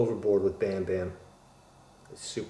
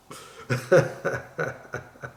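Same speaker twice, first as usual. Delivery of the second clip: high burst of helpless laughter; real laugh; 6 semitones higher than usual. Real, not acted. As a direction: crack up at the silly diagnosis. Cracks up at own joke.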